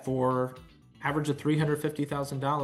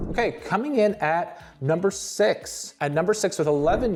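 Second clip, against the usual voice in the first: higher, hyped countdown announcement; top ten countdown; 6 semitones higher than usual. Acted. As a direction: announce the rank like a show host. Announcing ranked countdown entry.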